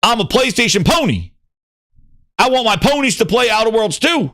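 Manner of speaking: high-pitched, emphatic mock proclamation; Satya, brash declaration. Mimicking the CEO as PlayStation fan, brash. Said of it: "Satya's like".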